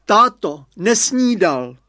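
high, loud mother's call; slow loud mother's yell. A narrator's reading. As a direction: mum running out shouting after them. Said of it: "vyběhla maminka a volá".

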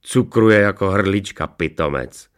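slow, loud mockery dropping on the insult; mock the master as a scornful dog; sneering hound. A narrator's reading. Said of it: "ušklíbil se Fekota". (posměšný chrtí hlas)

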